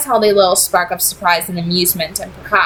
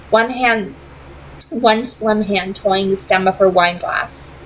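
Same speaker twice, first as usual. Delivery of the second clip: ordinary delivery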